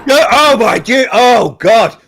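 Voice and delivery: loud, high, appalled groan; shocked outcry. Real, not acted. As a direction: cry out in mock horror. Reacting to embarrassing admission.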